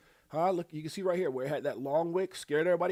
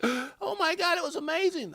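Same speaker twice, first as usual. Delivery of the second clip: gasp, then a much higher mock "oh my God"; mock amazement; 13 semitones higher, 4 dB louder than usual. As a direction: fake gasping awe, pitched way up. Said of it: "Now they're like".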